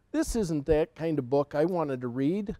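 ordinary delivery

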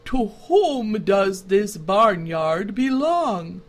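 slow, deliberate, wise scarecrow voice; measured like a patient old judge; calm stern scarecrow. A narrator's reading. Scarecrow questions the goat.

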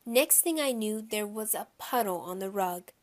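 plain reading voice

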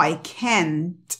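CAN'T drawn out as "kent" with a popped T; slow "I can't", T clearly exploded; exploded final T. Shown as a demonstration. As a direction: release the T at the end of CAN'T. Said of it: "ellos explotan la T".

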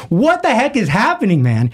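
high, re-enacted freak-out; alarmed bewilderment. Teacher to a shaking student in class, alarmed bewilderment. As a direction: demand an explanation in mock alarm.

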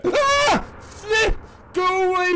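high, loud panicked yelp at the ghost; startled scream. Real, not acted. Gamer startled by the ghost, screaming in fright.

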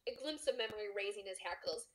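ordinary delivery

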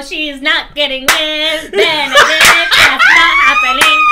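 rising to a high laughing shout; laughing protest. Real, not acted. Co-host rejecting the theory for Benedict, laughing shout. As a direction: refuse it outright, cracking up.

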